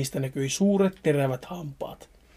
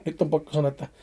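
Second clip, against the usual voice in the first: usual speaking voice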